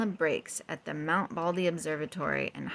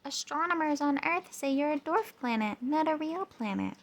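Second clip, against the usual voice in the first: high, breezy rocket voice; 9 semitones higher than usual. A narrator's reading. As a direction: rattle off the news high.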